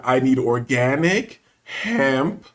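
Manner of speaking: drawn-out, word-by-word request; exaggeratedly slow. Man repeating his order to the clerk, very slowly. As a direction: stretch every word as if for someone slow.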